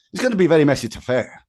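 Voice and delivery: put-on a rough go at a Scottish accent. [name] mimicking Duncan Bannatyne on Dragon's Den in a Scottish accent. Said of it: "I won't even attempt to do a Scottish accent, but I'll have a go".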